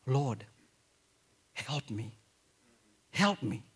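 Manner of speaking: soft, hushed plea to the Lord; a woman's whispered-down prayer for help; quiet plea. Retold or imitated. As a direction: pray it low and pleading. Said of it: "This was her answer".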